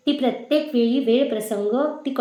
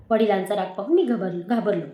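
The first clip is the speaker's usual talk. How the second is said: ordinary delivery, nothing marked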